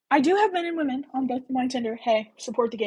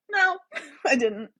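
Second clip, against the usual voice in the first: laughing; 8 semitones higher than usual. Real, not acted. Embarrassed about meeting Luke.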